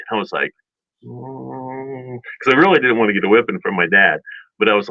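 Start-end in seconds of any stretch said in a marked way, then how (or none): 1.0-2.2 long, very soft deliberating "mm"; hesitant hum. Retold or imitated. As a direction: hum quietly as if weighing it up. Acted hesitation over the decision.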